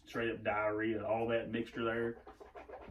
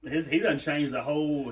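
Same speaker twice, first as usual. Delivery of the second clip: ordinary delivery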